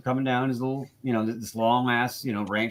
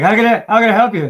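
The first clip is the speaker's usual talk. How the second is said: imitating a cop, higher, louder and fast; a cop politely greeting the ranch guys, mock voiced; retold cop; 8 semitones higher, 11 dB louder, faster than usual. Put on a cop asking how he can help, higher and louder. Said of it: "And he's like".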